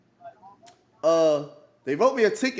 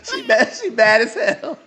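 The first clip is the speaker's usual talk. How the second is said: laughing; 8 semitones higher than usual. Real, not acted. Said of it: "laughing while telling".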